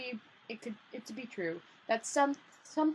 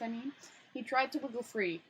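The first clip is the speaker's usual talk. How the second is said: plain reading voice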